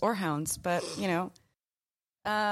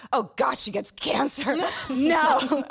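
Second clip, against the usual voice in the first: dramatic dismay; 7 semitones higher, 7 dB louder, slower than usual. Retold or imitated. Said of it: "It's like".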